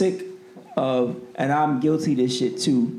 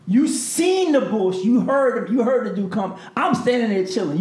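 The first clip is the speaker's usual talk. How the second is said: higher, pleading argument with the bouncer; exasperated protest; 6 semitones higher than usual. Retold or imitated. Retelling to the bouncer his protest, indignant and higher. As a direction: plead your case, indignant.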